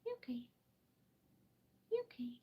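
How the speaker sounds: soft high-pitched coo to a pet. Real, not acted. Checking on the bird.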